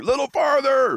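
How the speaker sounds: high-pitched call across the ice; retold yell. Angler yelling to the guy drilling outside the hut, retold yell. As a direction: yell it out like directions to someone far off. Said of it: "you'd yell out".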